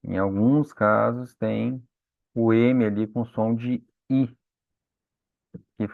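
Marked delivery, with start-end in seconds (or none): none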